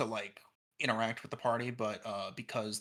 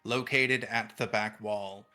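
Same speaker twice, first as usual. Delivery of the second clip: game master narration; 2 semitones lower, 5 dB louder, slower than usual. Acted. GM scene setting.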